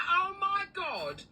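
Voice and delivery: sobbing with excitement; overjoyed cry. Retold or imitated. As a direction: cry it out in happy disbelief. Retelling the wonderful phone news.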